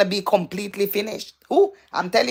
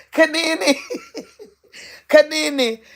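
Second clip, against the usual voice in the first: laughing; 5 semitones higher than usual. Real, not acted. Still laughing, calling a viewer.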